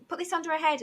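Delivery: mild retold scream. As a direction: imitate an urgent shout without full volume. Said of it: "I could hear a lady screaming".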